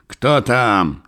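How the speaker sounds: commanding shout; thundering challenge. A narrator's reading. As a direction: boom it out at whoever is there. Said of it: "загремел Мышлоевский".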